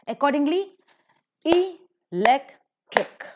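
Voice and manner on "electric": electric very slowly, broken into e-lec-tric; syllable by syllable. Shown as a demonstration. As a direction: separate the three syllables of electric, higher and louder. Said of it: "trisyllabic word it is".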